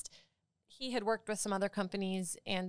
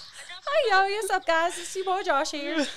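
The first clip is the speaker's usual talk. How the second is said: put-on high, loud Southern kid video intro; Josh; 11 semitones higher, 6 dB louder than usual. Friend echoing the played clip, high Southern little-kid accent. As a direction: copy the Southern kid's excited greeting. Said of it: "Do your impersonation of that one little kid".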